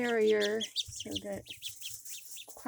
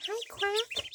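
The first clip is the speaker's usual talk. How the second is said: much higher, squeaky hello to a duckling; cooing pet voice; 11 semitones higher than usual. Real, not acted. Owner greeting her duckling in a high cooing voice. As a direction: coo a tiny greeting to the duck.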